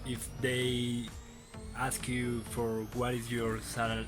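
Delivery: ordinary talk, nothing marked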